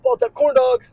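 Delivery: high, loud silly yell; excited yell. Acted. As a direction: shout it like a punchline. Silly escalation of joke.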